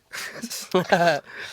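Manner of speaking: high burst of laughter; laughing. Real, not acted. Laughing at retold scene.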